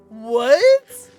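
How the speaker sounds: loud shocked what. Real, not acted. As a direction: blurt it out in shock.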